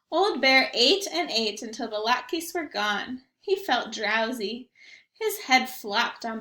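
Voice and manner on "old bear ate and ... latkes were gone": ordinary delivery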